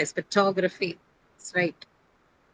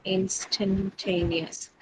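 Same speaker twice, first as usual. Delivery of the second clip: "Instantaneous" drawn out very slowly, softer; slow model word; 6 dB quieter, much slower than usual. Shown as a demonstration. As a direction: pace out "Instantaneous" syllable by syllable. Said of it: "Which is the stress syllable?".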